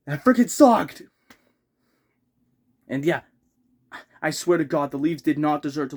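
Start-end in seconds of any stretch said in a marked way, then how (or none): 0.1-1.0 angry outburst. Real, not acted. Angry fan venting about loss.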